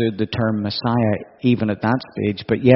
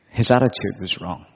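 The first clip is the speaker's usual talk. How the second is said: ordinary talk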